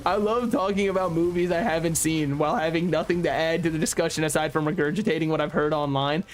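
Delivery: ordinary talk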